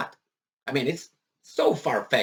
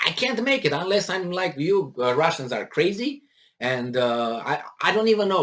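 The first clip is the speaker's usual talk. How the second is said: ordinary talk, nothing marked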